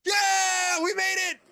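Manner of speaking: high, loud joyful shout; relieved laughter. Real, not acted. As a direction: yell it out, finally through. Finally through after long hold.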